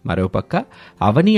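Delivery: usual speaking voice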